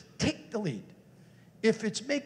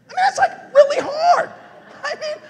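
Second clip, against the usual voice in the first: much higher, loud near-cry; real loud outcry on hard; emphatic outcry; 13 dB louder than usual. Real, not acted. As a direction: cry it out to the room. Speaker stressing how hard it is.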